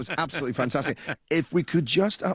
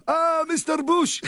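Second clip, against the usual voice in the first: very high, drawn-out welcome; delighted restaurant greeting; 3 dB louder, slower than usual. Retold or imitated. Iranian restaurant staff greet a regular by nickname, high delighted cry. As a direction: hail a favourite customer, stretched and pitched way up. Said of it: "every time I go in, they go".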